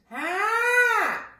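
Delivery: high, loud, cutting "ah"; front-resonant cry. Shown as a demonstration. Voice teacher showing front space, piercing ah. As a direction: project it sharply forward. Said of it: "Is the front of the body".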